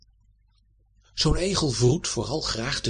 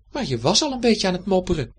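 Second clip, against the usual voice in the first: much higher and fast, chatty cat; 7 semitones higher, faster than usual. A narrator's reading. Cat teasing the hedgehog, high quick voice. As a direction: rattle it off as the curious cat. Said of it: "ging Dibbes door".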